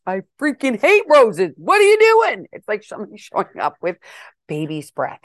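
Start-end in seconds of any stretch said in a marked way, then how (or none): 0.1-2.5 high, loud indignant outburst; outraged retort. Retold or imitated. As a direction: shriek it in mock disgust. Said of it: "And she's like".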